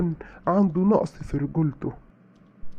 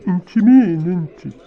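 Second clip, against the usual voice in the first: loud, contemptuous who are you; husband shouting down the other woman; angry outburst; 8 dB louder than usual. Acted. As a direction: blast her with scorn, much louder. Husband snaps at the mistress.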